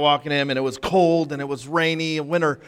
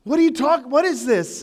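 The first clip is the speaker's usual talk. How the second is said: confused dog; 8 semitones higher than usual. Retold or imitated. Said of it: "he just looked at me like".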